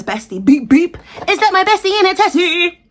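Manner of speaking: high, loud, bright squeal of a greeting. Retold or imitated. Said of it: "In the words of [name]".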